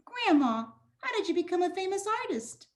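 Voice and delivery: put-on high, eager little girl asking; innocent, fun-loving young girl; curious granddaughter voice. Shown as a demonstration. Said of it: "the granddaughter being over here, fun loving".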